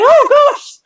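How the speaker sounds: very high, loud mock gasp of alarm; retold shocked exclamation. Neighbour seeing all the blood, retold high shocked cry. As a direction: imitate someone horrified, voice leaping up.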